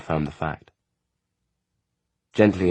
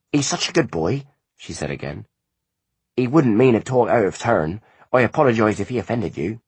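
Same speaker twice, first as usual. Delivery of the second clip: a lightly higher, earnest country mother; a mother pleading for her boy, a bit raised; anxious mother; 5 semitones higher, 4 dB louder than usual. A narrator's reading. Mother apologising to the visitors for her son, earnestly. Farm mother voiced in story.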